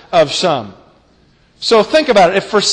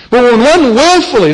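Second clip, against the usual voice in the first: raised, louder push on WILLFULLY; stress on willfully; 5 semitones higher, 5 dB louder than usual. Real, not acted. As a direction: drive home the word "willfully". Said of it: "I emphasize that word willfully".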